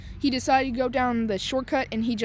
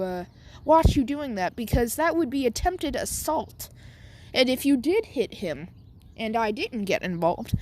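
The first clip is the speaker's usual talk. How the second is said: polite butler voice; 3 semitones higher than usual. Retold or imitated. Jarvis the robot butler warning Bakugo, prim and polite. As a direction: lecture him politely like a proper butler. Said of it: "Jarvis like".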